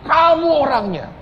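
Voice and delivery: high, loud accusing cry; retold shout. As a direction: re-enact the pointed outburst at full voice. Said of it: "dia tunjuk Anda dengan keras".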